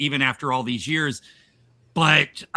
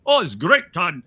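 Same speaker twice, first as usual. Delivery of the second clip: Domiziano; 2 semitones higher, 4 dB louder than usual. Acted. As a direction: play at a foreign accent, louder. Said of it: "I'm Domiziano and I'm going".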